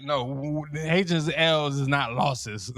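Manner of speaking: ordinary delivery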